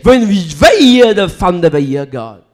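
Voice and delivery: loud, rising sermon cry; fiery preaching. Acted. Preacher crying out to the congregation, fiery. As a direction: preach it out with full force.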